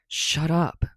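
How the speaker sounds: short irritated snap; a touch of a grumpy snap; snapped. A narrator's reading. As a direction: snap it back at him. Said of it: "Bakugo snapped".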